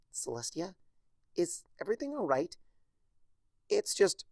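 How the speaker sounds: anxious, rising and rushed; worried young voice, hurrying. A narrator's reading. Twilight worried about sick Celestia.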